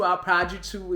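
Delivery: half-hearted Antillean accent imitation; mediocre Antillean accent. Shown as a demonstration. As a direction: have a rough go at an Antillean accent. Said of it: "Désolée, mon accent est médiocre".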